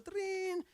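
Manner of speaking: high imitated phone ring "trin"; mock phone ring. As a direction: chirp it like a ringing phone.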